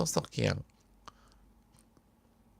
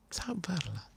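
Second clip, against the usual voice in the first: soft whisper of patience; whispered comfort; 7 semitones higher, 13 dB quieter, slower than usual. Acted. Preacher whispering patience to the suffering family. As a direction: breathe it like a comfort.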